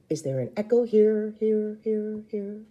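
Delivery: fading repeated echo; echo effect. Shown as a demonstration. Imitating Dad's canyon echo game, fading away. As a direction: let each here fade like an echo. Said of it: "making echoing sounds".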